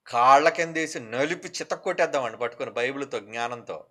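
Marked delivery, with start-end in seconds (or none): none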